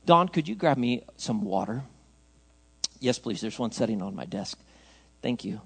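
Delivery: low, hushed request off to the side; quiet aside. Real, not acted. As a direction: drop voice to ask a helper. Aside asking helper for water.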